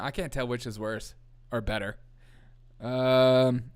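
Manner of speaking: normal talking voice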